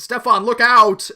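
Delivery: loud warning cry; warning shout. Acted. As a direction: yell out a warning. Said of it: "Rio goes".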